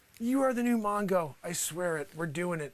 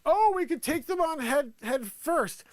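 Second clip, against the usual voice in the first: cocky attackers; 8 semitones higher than usual. Retold or imitated. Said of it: "they're like".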